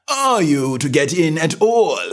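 high and slow, drawn out; slow footman. A narrator's reading. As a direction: settle in without hurry. Footman answers.

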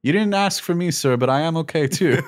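high-pitched, put-out droid voice; C-3PO impression. Droid answering Poe unasked, high-pitched C-3PO impression. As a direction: imitate a fussy droid speaking up for itself. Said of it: "C three P O's like".

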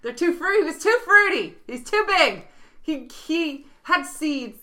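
high, loud eager guesses; excited guessing. Real, not acted. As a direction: blurt out answers excitedly. Excitedly guessing the joke's answer.

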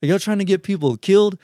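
high-pitched mock yell; retold screaming. Dude beside them at the drive-thru, mock screaming. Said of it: "he started screaming".

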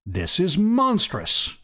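high, slow, drawn-out indignation; outraged. A narrator's reading. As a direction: draw it out, scandalised old Duke. Old Duke outraged at being doubted.

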